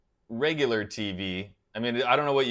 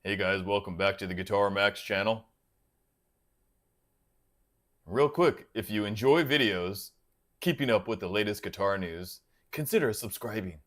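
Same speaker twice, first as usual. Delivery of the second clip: lower, slicker YouTube host voice; a lower put-on channel-intro voice; Guitar Max impression; 4 semitones lower than usual. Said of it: "you gotta do the Guitar Max voice".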